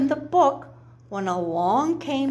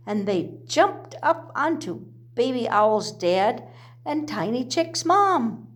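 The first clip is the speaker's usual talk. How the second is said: plain reading voice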